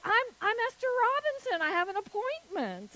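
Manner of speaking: high, fast, stumbling retold reply; flustered stammer. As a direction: imitate a nervous applicant rushing to explain. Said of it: "And I stammered and I said".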